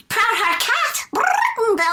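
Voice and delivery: high, drawn-out cartoon cat voice; Proud Heart Cat. Shown as a demonstration. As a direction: stretch it out in a high-pitched cat character. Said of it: "I was Proud Heart Cat".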